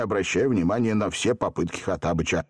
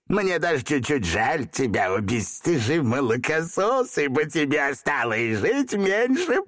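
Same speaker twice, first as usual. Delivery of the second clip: gloating genie; 9 semitones higher than usual. Acted. Gloating laugh, old genie.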